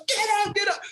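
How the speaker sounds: put-on high, urgent accented shouting; imitated shout. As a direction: order him up like the frantic coach. Coach yelling at player.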